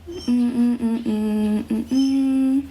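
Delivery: ordinary talk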